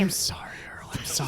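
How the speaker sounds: hushed, sheepish apology; whispered apology. Acted. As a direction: whisper sorry to the squirrel. Apologizing softly to squirrel.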